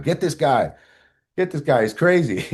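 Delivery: flag down the cops in a panic; scared street people. Retold or imitated. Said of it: "waved the cops down".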